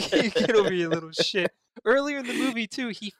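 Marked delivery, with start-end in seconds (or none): 0.0-1.5 louder mock growl; silly clown. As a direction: taunt like a petty clown. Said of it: "he looks so disappointed".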